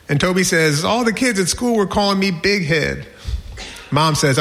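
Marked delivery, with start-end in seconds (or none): 0.8-3.1 lightly voiced whining child, higher pitch; a hint of a tearful kid; upset little boy. Toby tells mom about the teasing, upset. Voicing crying kindergartner.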